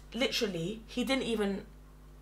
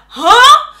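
high, loud exclaimed huh; shocked; 13 semitones higher, 16 dB louder than usual. Retold or imitated. Reacting to a colleague's threesome proposal, shocked. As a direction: blurt it out loud and high in disbelief.

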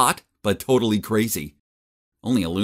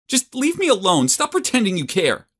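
put-on much higher, hurt girl's voice; 10 semitones higher, 3 dB louder than usual. Hurt girl on the swing snaps at the boy, upset. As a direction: push him away, upset. Sad girl pushing him away.

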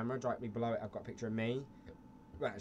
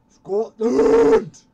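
shouting; 12 semitones higher, 21 dB louder than usual. Pretend. Patriotic yell in character.

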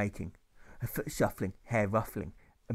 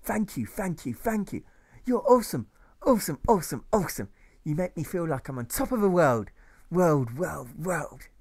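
higher, louder gushing, over and over; gushing thanks; 5 semitones higher, 4 dB louder than usual. Acted. Alfred thanking his Sunday school teacher, gushing and repeating. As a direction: gush with delight, repeating yourself. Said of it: "he says, as he becomes repetitious".

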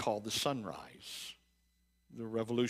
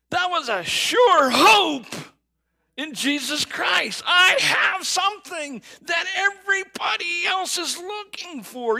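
high, loud preaching that climbs to shouted peaks; impassioned sermon at full volume, much higher pitch; full-force preaching; 10 semitones higher, 9 dB louder than usual. Acted. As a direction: preach it out, building to a cry.

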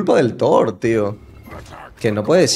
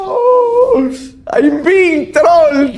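exasperated laughing; 6 dB louder, much slower than usual. Real, not acted. Risa y queja.

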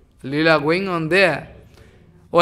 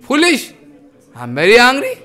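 angry demand; 7 semitones higher, 4 dB louder than usual. Acted. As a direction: scold him for being late, starving. Nityananda kicks, demands food.

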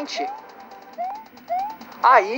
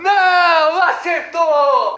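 gritos agudos e fortes, rindo; grito de vitória; 4 semitones higher, much slower than usual. Real, not acted. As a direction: comemora o golpe final gritando. Grito de vitória com risada. (grito de vitória com risada)

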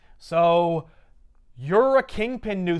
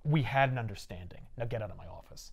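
low, quiet menace; cold threat; 7 semitones lower, 7 dB quieter than usual. Retold or imitated. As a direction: drop it down, ice cold. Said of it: "he goes".

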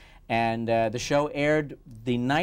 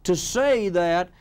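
put-on slow, higher Southern accent; Southern Clinton voice; 9 semitones higher, 6 dB louder, much slower than usual. Impressionist does Clinton, slow higher Southern accent. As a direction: drawl it out slowly in a Southern accent. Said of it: "he's got that Southern accent going".

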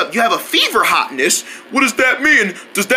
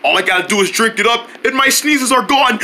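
ordinary delivery

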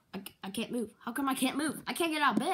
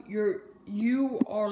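ordinary talk, nothing marked

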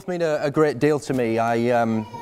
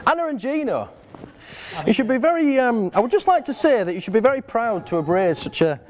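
raised voice, laughing through the praise; reading out his bride's words; 6 semitones higher than usual. Retold or imitated. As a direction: read her note aloud, grinning. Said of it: "So in Sarah's own words".

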